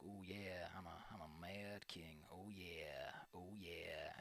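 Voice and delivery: low, soft, slow self-satisfied croon; lazy low gloating oh yeah; smug mad king. Retold or imitated. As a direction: purr it like a king loving himself. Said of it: "Maybe he's like this".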